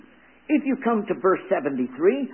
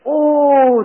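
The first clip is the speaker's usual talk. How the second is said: loud, high ringing "Oh"; prayerful exclamation; fervent cry; 9 semitones higher, 14 dB louder than usual. Acted. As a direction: cry it out to heaven. Opens the quoted prayer.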